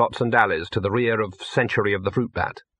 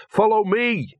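urgent command; 10 semitones higher, slower than usual. A narrator's reading. Dog urged to follow.

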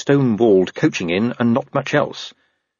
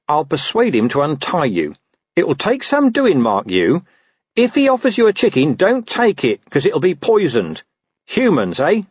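higher, sly talking dog; sly mongrel conning the wolf; Gaspode dog voice; 6 semitones higher than usual. A narrator's reading.

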